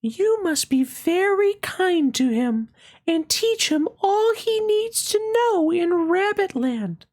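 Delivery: very high, gentle fairy voice. Acted. Fairy greeting the little rabbit, high and gentle. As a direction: sing it out light and high like a fairy.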